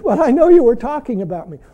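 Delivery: usual speaking voice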